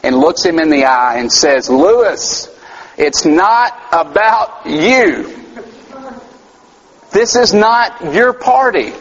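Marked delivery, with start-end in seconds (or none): 1.7-8.9 a mother's higher, slow, firm correction. Retold or imitated. Mother firmly telling her sulking son the party isn't his. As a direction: lean down and set the child straight, slowly.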